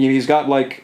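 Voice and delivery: usual speaking voice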